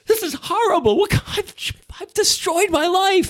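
hammed-up anguished falsetto; despairing whine. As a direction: wail it like your life is ruined. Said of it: "And I was like".

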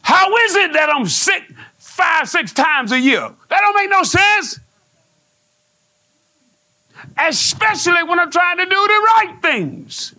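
rising preaching shout. Real, not acted. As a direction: preach it up to full force.

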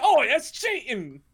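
put-on high, slow Australian accent, outraged; whiny Australian protest. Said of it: "Do you like my Australian bingo accent".

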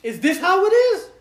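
high, loud, wounded mock drama; a drunk friend makes a scene leaving the car; extra dramatic. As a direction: overplay the hurt friend. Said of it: "this is him being extra dramatic".

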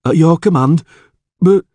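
loud, high-pitched subordinate voice; obey promptly, then falter; hesitant guard. Acted. Town guard accepting the alderman's order, hesitant.

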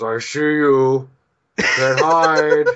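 slow, singsong stalking bear. Retold or imitated. Bear hunting people under a tree, slow taunt. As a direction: stretch it out as a teasing threat. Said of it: "The bear goes".

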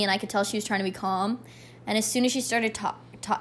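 usual speaking voice

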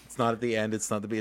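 normal talking voice

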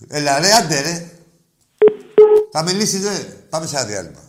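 usual speaking voice

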